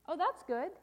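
high exclamation of approval; delighted refrain. Acted. Storyteller reacts to friendly lion, delighted exclamation. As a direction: cheer the good news.

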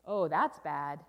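loud, low groan of dismay; dismayed refrain. Acted. Storyteller reacts to burst balloon, loud dismay. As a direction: lament the bad news heavily.

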